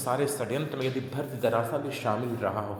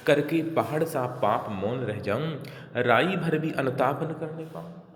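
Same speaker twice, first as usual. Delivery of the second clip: slow start, rising into higher pitch; verse recitation; 3 semitones higher than usual. Acted. Kaikeyi demanding the right to repent, emphatic verse recitation. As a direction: recite it as an insistent plea.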